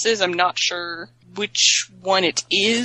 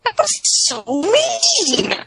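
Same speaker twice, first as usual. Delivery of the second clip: very high, drawn-out protest; stretched-out, high-pitched scolding; mock outrage; much slower than usual. Real, not acted. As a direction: wail it in indignant reproach.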